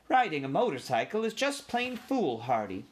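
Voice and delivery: put-on elderly aunt laying down the law; disapproving old aunt. A narrator's reading.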